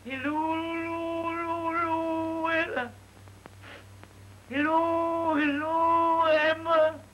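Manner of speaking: long, slow, high drawn-out calls; calling out. Retold or imitated. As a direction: stretch each hello up the stairs, high and loud. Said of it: "I cried out".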